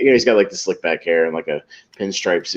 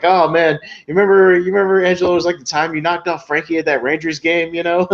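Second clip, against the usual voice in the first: high-pitched retold mobster banter, loud at the start; chummy reminiscing; 8 semitones higher, 3 dB louder than usual. Mobster reminding Angelo of a past hit, put-on chummy excitement. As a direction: play an eager pal recalling old exploits. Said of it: "just making chit chat".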